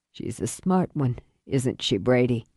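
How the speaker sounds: low, soft, weakened voice; dying father. Acted. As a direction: speak low with failing strength. Dying father addresses rescuer.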